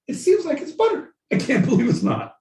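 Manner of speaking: very high, squeaky spoof voice; squeaky parody of an amazed advert; mock astonishment. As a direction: leap far up in pitch, hammed-up disbelief. Spoofs butter advert line.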